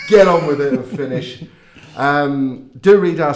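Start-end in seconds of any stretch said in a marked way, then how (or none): 0.0-1.4 laughing. Real, not acted. Laughing, mocking co-host's demand.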